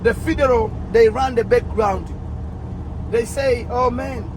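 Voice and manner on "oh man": ordinary talk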